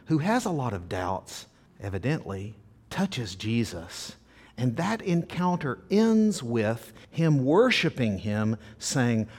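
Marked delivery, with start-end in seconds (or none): none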